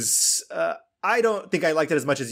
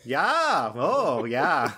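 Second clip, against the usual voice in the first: put-on drawn-out, swooping Fargo accent; comic Fargo-accent agreement; imitated Fargo accent; much slower than usual. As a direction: play a chatty Fargo local, vowels stretched. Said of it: "and they're like".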